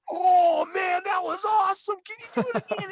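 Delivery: high, loud thrilled fan voice; mock fan excitement. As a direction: gush like an awestruck fan. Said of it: "people would be like".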